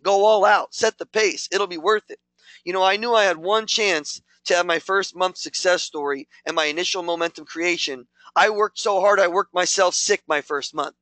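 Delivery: ordinary talk, nothing marked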